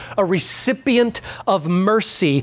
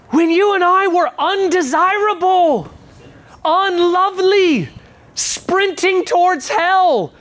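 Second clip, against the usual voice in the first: full-force preaching; 9 semitones higher than usual. Acted. Pastor correcting the congregation's theology, in full-force preaching. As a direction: preach it hard and high, nearly shouting. Preaching climax against the meme.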